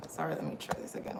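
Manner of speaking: fast half-whispered mutter; hushed aside. Real, not acted. Streamer apologizing to viewers, fast hushed mutter. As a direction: mutter it quickly under your breath.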